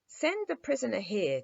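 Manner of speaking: commanding. A narrator's reading. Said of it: "commanded the king".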